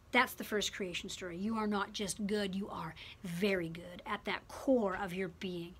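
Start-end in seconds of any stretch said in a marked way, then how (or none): 1.4-5.7 soft whisper, much quieter; a hushed, whispered blessing. Real, not acted. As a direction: whisper it close like a secret. Intimate affirmation to congregation.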